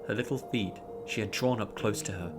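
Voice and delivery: plain reading voice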